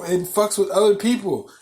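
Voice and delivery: ordinary talk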